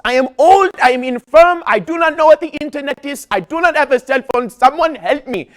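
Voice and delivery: high, loud, desperate yell; screaming for help. Retold or imitated. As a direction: shout to the whole room like a helpless old man. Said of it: "began screaming at the top of his lungs".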